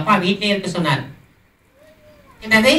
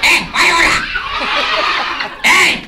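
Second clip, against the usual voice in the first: laughing shout; 7 semitones higher than usual. Acted. Scolding the kids in the audience.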